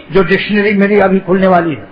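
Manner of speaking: ordinary delivery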